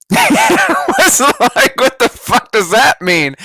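slow, high words bursting through laughter; incredulous laughing. Real, not acted. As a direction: laugh it out in disbelief. Incredulous laughing at mentor's remark.

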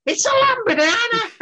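high, squeaky Liverpool exclamation; excited Scouse shout of the name; Scouse accent. Retold or imitated. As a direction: pipe it up in a thick Scouse voice like a passer-by. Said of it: "Goes well with the accent".

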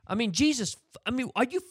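high, exasperated outburst; losing it. Retold or imitated. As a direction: retell the moment you snapped at the clerk. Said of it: "I lost it".

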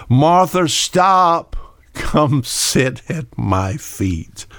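a hint of Jesus calling gently; calling Martha. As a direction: call her name high, then settle into a slow gentle invitation.

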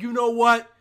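loud, furious dictator threats; a dictator yelling at his people; ranting dictator. Retold or imitated. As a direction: bellow threats at the crowd. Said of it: "and the guy's like".

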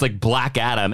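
ordinary talk, nothing marked

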